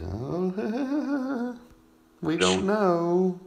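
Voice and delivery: high, drawn-out giggly squeal; mock-giddy, stretched out and high; giggling like schoolboys. Teasing acted giggly schoolboy excitement.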